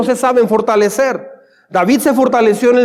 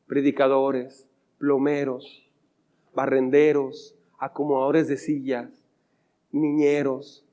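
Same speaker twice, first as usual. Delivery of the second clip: slow, quiet, drawn-out listing; a professor retold, lower and softer; slow stern list; 8 semitones lower, 9 dB quieter, slower than usual. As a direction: lay out each duty slowly and heavily.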